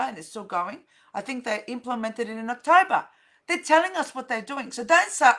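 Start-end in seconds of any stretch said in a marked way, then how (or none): none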